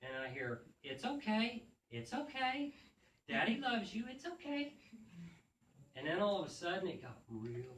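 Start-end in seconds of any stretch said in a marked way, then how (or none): 0.8-4.7 put-on high child voice, reassuring the cat; little boy soothing. Son Ethan calming his wet cat, high boyish soothing. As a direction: coax the cat gently like a small boy.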